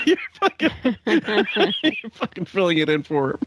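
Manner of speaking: high, slowed words breaking into real laughter; laughing hard. Real, not acted. Streamer reacting to the comic's punchline, laughing hard. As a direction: struggle to talk through helpless laughter. Cracking up at played story.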